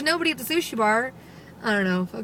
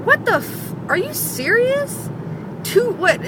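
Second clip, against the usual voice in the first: exasperated, incredulous outburst; 10 semitones higher than usual. Retold or imitated. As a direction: balk at absurd news. Reacting to people still off work.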